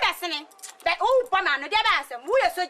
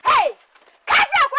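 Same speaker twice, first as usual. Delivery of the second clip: high, loud sudden yell; furious yell; 11 semitones higher, 6 dB louder than usual. Acted. Scolding the idler, sudden furious yell. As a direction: explode with one word.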